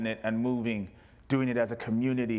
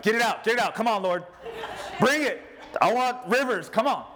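fervent plea; 10 semitones higher than usual. Retold or imitated. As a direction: call out eagerly for the tears to come. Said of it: "I'm like".

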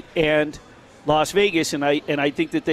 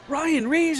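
retold yell; 10 semitones higher than usual. Retelling a friend hailing a player at the stadium, mock yell. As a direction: call out a name high, volume held back. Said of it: "and he yelled".

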